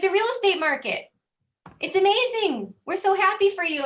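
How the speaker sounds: high, loud gushing cheer; mock cheering neighbors. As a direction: gush like neighbors raising a glass. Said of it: "They literally started cheering me".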